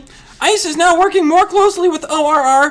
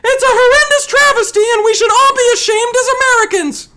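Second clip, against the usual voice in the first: very high, raised voice; high-pitched, emphatic indignation; a notch higher; 8 semitones higher, 3 dB louder than usual. Acted. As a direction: push it right up high in outrage. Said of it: "Just a notch higher".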